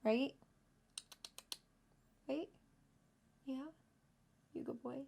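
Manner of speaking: quiet, tender words to her pet; soft pet talk. Real, not acted. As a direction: coo gently at the pet. Talking softly to her pet.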